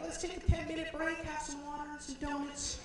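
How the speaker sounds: high, mock-cheery adult voice; nervously smooth things over with snacks; flustered organizers. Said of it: "they were like".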